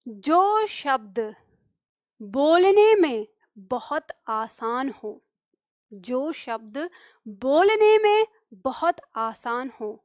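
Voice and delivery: slow, spaced dictation for notes. Shown as a demonstration. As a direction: spell it out slowly so they can write. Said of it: "star लगा के". (धीमे-धीमे लिखवाते हुए)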